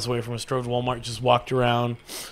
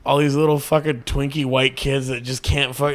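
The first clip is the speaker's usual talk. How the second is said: ordinary talk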